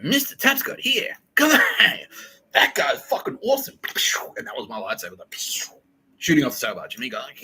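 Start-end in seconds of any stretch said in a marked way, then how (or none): 0.0-3.8 much higher, slow, gushing voice; hammed-up high-pitched admirer, louder; squeaky fan voice. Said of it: "She's like".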